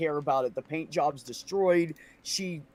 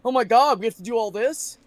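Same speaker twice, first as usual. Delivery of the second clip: higher and louder mock panic of coworkers; 7 semitones higher, 8 dB louder than usual. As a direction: ham up their panic at the workload. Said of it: "for them to all go".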